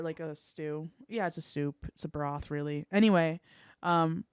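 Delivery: normal talking voice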